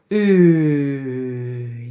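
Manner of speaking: "U" sustained low as Dutch uu; long rounded vowel. Shown as a demonstration. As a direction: hold "U" long, lips puckered for a kiss. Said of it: "almost want to kiss someone".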